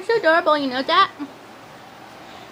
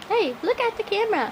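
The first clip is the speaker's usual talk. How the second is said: ordinary delivery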